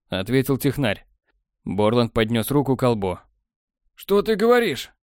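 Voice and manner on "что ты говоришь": ordinary delivery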